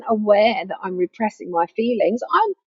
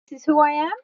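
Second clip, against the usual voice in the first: raised, doubtful question; questioning wrong answer; 4 semitones higher, much faster than usual. Shown as a demonstration. Pointing to the head, a doubtful questioning tone. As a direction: ask it as if unsure.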